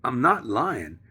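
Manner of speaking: loud, high retold warning; emphatic warning. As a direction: lay down the warning at full volume. Acted warning to the landlord.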